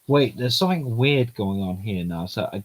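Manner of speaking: normal talking voice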